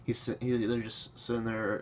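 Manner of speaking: normal talking voice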